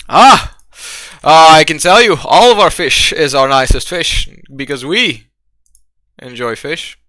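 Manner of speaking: theatrical innkeeper, loud exclamations then drawn-out words; hammed-up, halting tavern host; eager bartender. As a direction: pitch the fish to a customer with showy pride. Bartender takes the fish order.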